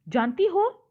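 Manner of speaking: astonished question with a rising end; surprised question. Shown as a demonstration. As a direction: lift the ending high in mild amazement. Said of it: "यहाँ पे आश्चर्य की बात है".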